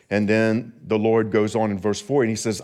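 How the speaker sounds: ordinary talk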